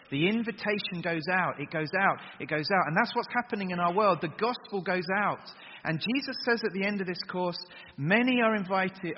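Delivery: loud, ringing preacher's voice driving each phrase home; full-force preaching. Acted. Preacher to youth groups about the gospel, ringing and loud. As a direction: proclaim it to the whole hall.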